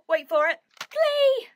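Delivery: loud build-up to a very high squeal; gleeful high-pitched shout; excited reveal. Pretend. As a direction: tease it, then shriek the title.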